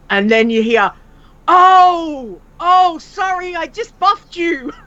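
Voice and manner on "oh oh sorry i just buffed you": high-pitched, drawn-out mock apology; apologetic stranger. Stranger who buffed an Iron Man player, gushingly apologetic. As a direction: imitate an over-eager player saying sorry.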